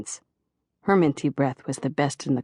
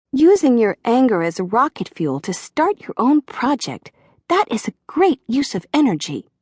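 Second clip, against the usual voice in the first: put-on higher little-girl therapist voice, cheerful; sweet, high praise like a child; little girl's voice; 7 semitones higher, 4 dB louder than usual. A narrator's reading. Joyce praises John's plan in a higher girlish voice. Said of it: "almost a little girl's voice".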